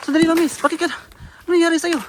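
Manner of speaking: high, loud panicked calls; shouting at the woman who attacks him, panicked; alarmed shouting. Real, not acted. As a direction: yell at her to stop.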